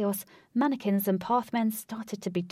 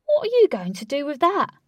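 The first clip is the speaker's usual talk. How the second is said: incredulous; 8 semitones higher, 8 dB louder than usual. Acted. Incredulous at fishing rod.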